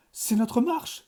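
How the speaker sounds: joie éclatante, bien plus haut; s'exclame ravi; cri ravi. A narrator's reading. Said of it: "s'écria-t-il ravi". (le professeur ravi s'écrie)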